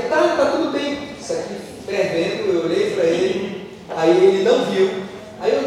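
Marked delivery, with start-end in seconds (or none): 0.0-1.1 strained fake reassurance, higher and slow; forced calm. Burned father to his son, faking that all is fine. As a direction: pretend nothing is wrong through the pain.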